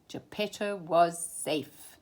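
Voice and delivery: plain reading voice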